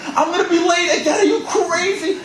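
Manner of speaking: high, loud, laughing panic; hammed-up panic; panicked whine. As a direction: squeal about being late. Panicked complaint while hobbling.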